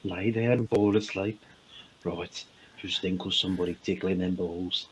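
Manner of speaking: hushed, low Brummy bedtime voice; Brummy accent. Acted. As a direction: coax them asleep softly in Brummy. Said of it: "you could fall asleep listening to a Brummy".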